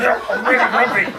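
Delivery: hammed-up grumpy, laughing through it; mock grumpy. [name] claiming to be grumpy, laughing mock sulk. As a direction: pretend to sulk and fail. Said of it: "He's like".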